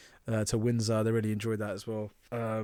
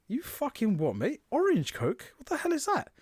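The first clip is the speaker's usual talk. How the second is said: high incredulous rant climbing; rising disbelief, higher each line; baffled and climbing high at orange Coke; baffled outrage; 12 semitones higher than usual. Retold or imitated.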